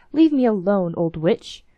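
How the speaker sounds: crying out. A narrator's reading. Minister's son rejects the begging old woman, loud cry. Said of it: "cried the young man".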